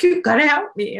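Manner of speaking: high, loud, frantic plea for help; panicked plea. Retold or imitated. Panicked dorm mate begging the narrator for help, high and frantic. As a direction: burst in begging, terrified. Panicked girl bursting into room.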